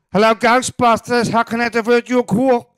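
loud, high New York switchboard voice; Janine, Bronx accent. Retold or imitated. Imitating Ghostbusters receptionist answering calls, loud high Bronx accent. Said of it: "the kind of Bronx, New York accent".

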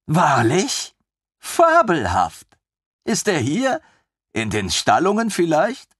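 put-on high, bright-eyed young officer, gushing; a thrilled lieutenant who can't believe his luck; eager lieutenant voice. Lieutenant gushing over the queen's griffin, high and eager.

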